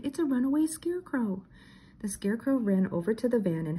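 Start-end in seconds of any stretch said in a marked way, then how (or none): 0.0-1.4 much higher, jittery cartoon panic; alarmed exclamation. Shaggy points out the scarecrow to the gang, panicky. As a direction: blurt it out, spooked. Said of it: "Shaggy exclaimed".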